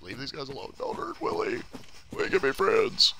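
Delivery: unvoiced, pleading whisper. Real, not acted. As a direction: beg them quietly. Pleading with game enemies.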